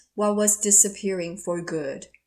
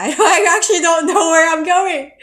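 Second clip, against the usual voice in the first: laughing; 7 semitones higher, 10 dB louder than usual. Retold or imitated. Retold reply to driver, laughing.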